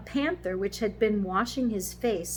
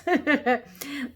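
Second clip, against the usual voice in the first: bright burst of giggling, higher and louder; amused laugh; 7 semitones higher, 7 dB louder than usual. Real, not acted. Reader tickled by the Cockney word, laughing. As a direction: break up at your own attempt.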